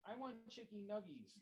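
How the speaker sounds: put-on high demanding child's voice; a little girl yelling what she wants; shouting little girl. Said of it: "the little girl shouted".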